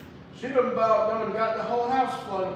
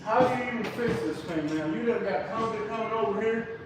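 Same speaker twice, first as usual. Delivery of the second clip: normal talking voice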